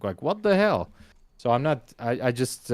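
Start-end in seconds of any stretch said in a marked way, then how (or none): 0.2-0.9 shocked. Retold or imitated. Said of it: "I was like".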